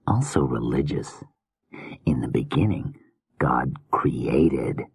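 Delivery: soft creepy murmuring killer; hushed, unsettling madman musing; menacing whispery killer. Acted. Killer muses on art.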